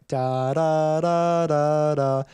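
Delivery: flowing, joined-up "da" syllables, intoned; legato. Shown as a demonstration. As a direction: hold each "da" right into the next. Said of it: "So everything was flowing".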